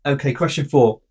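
normal talking voice

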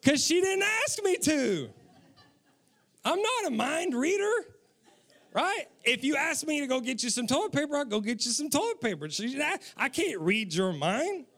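high-pitched, protesting husband; comic indignation. Acted. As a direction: plead your case in hammed-up indignation. Husband's mock defence against wife.

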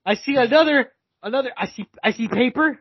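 high, halting crystal-ball vision; mock fortune teller. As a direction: play a psychic straining to make out a vision. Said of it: "Look into your fucking crystal ball".

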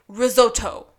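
risotto with a very hard, crisp T; hard-T pronunciation. Retold or imitated. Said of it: "with a very hard T".

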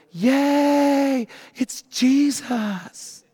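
high, drawn-out fake hooray; mock cheer. Disciples imagined clapping for Jesus, mock cheering. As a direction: parody a childlike, delighted welcome. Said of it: "do not clap their hands and go".

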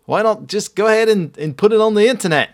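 jokey high, loud Australian bloke voice; hammed-up Australian mate; Australian accent. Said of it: "That's Australian".

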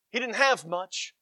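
ordinary delivery

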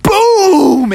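shouted sound-effect boom; loud boom. Acted. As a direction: belt out the crash of the wave. Wave slams them.

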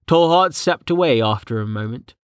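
ordinary delivery

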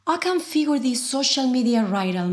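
ordinary talk, nothing marked

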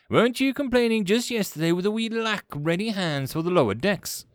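high-pitched, testy challenge; irritated commander. Acted. Commander questions objecting first officer, testy. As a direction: challenge your subordinate, peeved.

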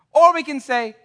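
ordinary delivery

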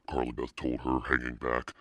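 usual speaking voice

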